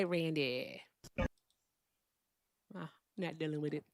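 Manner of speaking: normal talking voice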